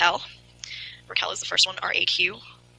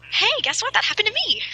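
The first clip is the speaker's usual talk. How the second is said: much higher, bright quip; playful excitement; 14 semitones higher, 3 dB louder than usual. Real, not acted. Daughter teases her parents, suddenly much higher and playful. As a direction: jump up high, teasing. Daughter jokes about shared flat tire.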